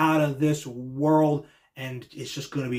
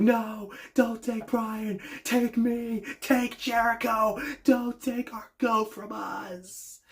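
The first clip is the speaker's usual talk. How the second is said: much higher, slow wailing plea; fans begging; 9 semitones higher, much slower than usual. Retold or imitated. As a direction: plead to save your hero, hammed up. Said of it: "when they're just like".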